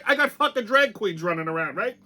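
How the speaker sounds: ordinary delivery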